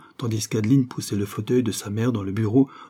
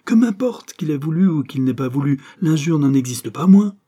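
louder, higher, rising fury; mounting anger; 5 semitones higher, 6 dB louder than usual. A narrator's reading. Mother refusing her son's excuse, angry. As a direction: drive the point home in anger.

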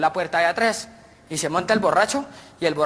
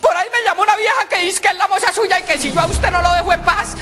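high wife voice; 12 semitones higher than usual. Acted. Wife reports a call from the mistress, high whiny voice. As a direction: whine it like an upset wife.

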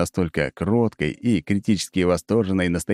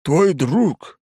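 high, loud overblown pathos; hammy stage actor; 9 semitones higher, 4 dB louder than usual. A narrator's reading. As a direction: ham it up like a bad tragedian. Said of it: "непомерно пафосно произносит тот".

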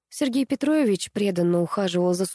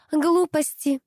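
high-pitched impatient dismissal; irritated, pitched-up exclamation; impatient outburst; 9 semitones higher, slower than usual. A narrator's reading. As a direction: snap it out high, stamping a foot. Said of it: "топнула ногой Нина".

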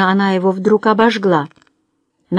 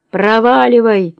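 slow, raised yell; 3 semitones higher, 4 dB louder than usual. A narrator's reading. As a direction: bellow at her to get out. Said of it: "заорал Хьюарт".

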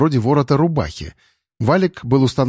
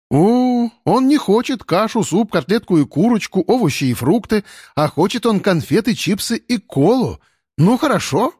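put-on higher, whiny kid voice; spoiled child voice; 8 semitones higher than usual. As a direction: voice a spoiled little boy.

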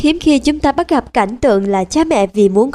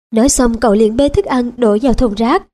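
ordinary talk, nothing marked

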